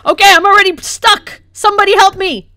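loud, high cry for help; exasperated plea. Real, not acted. Stuck in game, calls for help.

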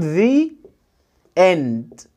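"the" said as "thee" before "end"; "thee end", clearly modelled; "thee" before a vowel. Shown as a demonstration. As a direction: say "the" with a long ee, then "end". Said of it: "عايز أقول النهاية، هقول".

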